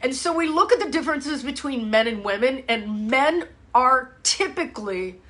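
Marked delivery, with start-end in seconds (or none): none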